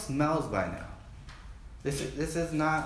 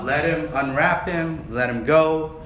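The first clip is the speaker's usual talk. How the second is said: commanding Jesus; 4 semitones higher, 7 dB louder than usual. Retold or imitated. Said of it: "And Jesus is like".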